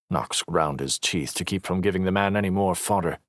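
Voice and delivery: ordinary delivery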